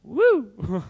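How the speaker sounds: high, loud "woo"; extrovert whoop. Acted. Speaker playing extroverts to the crowd, exuberant whoop. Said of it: "extroverts are always like".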